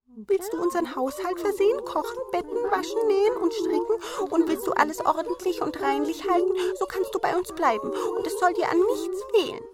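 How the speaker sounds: hohe Zwergenstimme, teils schnell; Zwerge bieten an. A narrator's reading. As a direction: freundlich hoch aufzählen. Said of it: "Die Zwerge sprachen". (hohe Zwergenstimme)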